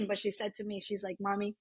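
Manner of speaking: real, soft, choked-back feeling; getting emotional. Real, not acted. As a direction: drop softly, holding back tears. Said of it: "this is gonna make me emotional".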